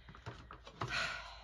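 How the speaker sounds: long, voiceless sigh out; breathy settling sigh; deep sigh. Real, not acted. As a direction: exhale to settle before starting. Settling before reading.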